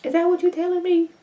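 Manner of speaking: high, fast, sassy put-on voice; sassy callout. Teasing Donald about the shower line, high sassy voice. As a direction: needle him with a high teasing voice. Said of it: "I was like".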